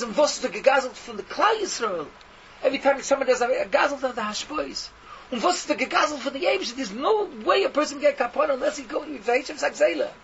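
loud, high, relentless accusation; roaring rebuke. Retold or imitated. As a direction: hammer each charge home at full roar. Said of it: "with his roaring voice".

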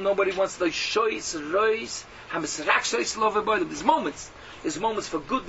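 normal talking voice

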